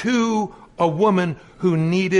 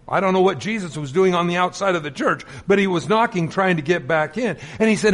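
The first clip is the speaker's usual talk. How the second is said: usual speaking voice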